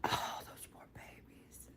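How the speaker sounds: hushed mock-grieving whisper; a soft whispered lament. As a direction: breathe it like a sorrowful aside. Mock grief over documentary.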